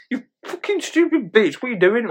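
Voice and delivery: high-pitched indignant scolding; mock outrage. Cat owner to the cat that scratched him, mock outraged. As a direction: imitate scolding a pet, voice shooting up.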